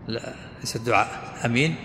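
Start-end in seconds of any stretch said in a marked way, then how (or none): 1.4-1.7 no lengthening on the opening vowel of "amin"; short hamza in "amin". Shown as a demonstration. Teacher saying this form is not supplication, short-voweled "amin". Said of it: "قصر الهمزة".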